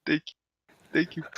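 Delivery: put-on Indian accent. Drunk scholar thanks girl for water, high Indian accent. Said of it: "in his fucking Indian accent".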